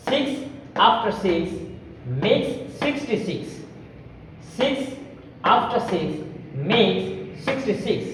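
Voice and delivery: six said slowly with a crisp s; sixty-six with each s hissed clearly and slowly; careful s drill. Shown as a demonstration. Teacher demonstrating the s sound, slow and crisp. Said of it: "সুন্দর করে আমরা উচ্চারণ করতে পারবো".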